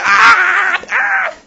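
sharp mock cries of pain, very high and loud; hammed-up burning-hand scream; pained cry. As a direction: yelp as if a hand is in fire.

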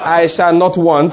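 loud, slow declaration; like you mean it. Shown as a demonstration. Preacher leads congregation in declaring the psalm, slow and emphatic. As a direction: proclaim each word slowly and loudly. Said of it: "do it like you mean it".

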